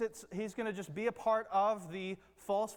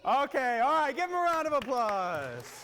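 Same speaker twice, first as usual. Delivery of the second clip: loud, raised voice over a crowd; calling out; 4 semitones higher, 7 dB louder than usual. Real, not acted. Leader calls over cheering children for applause, loud and raised. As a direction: call for applause high and loud.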